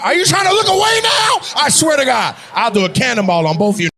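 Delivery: loud, high, fast mock threat; mock threatening shout. Comedian yelling at audience members who look away, mock threat. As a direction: yell it at them like playground bully.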